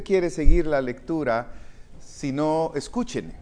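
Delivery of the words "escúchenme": normal talking voice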